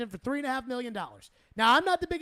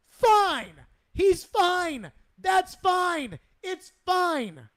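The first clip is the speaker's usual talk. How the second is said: higher, louder exasperated rant; 5 semitones higher, 5 dB louder, slower than usual. Real, not acted. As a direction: keep insisting it's fine, fed up. Host venting over backup goalie.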